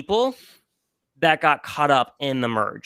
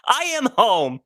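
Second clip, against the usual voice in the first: high, drawn-out cry of elation; overjoyed exclamation; 5 semitones higher, slower than usual. Retold or imitated. Fan reliving the sight of Colony nine, elated. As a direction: act out a thrilled homecoming, pitch soaring. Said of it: "and I'm like".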